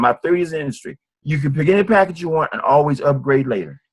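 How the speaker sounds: ordinary talk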